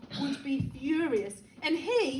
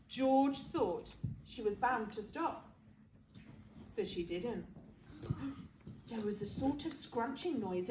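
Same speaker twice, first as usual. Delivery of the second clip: usual speaking voice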